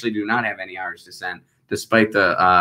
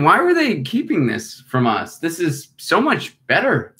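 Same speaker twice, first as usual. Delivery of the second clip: mock amazement; 8 semitones higher, slower than usual. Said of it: "I'm like".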